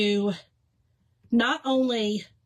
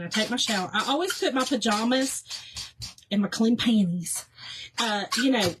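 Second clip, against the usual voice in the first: ordinary talk, nothing marked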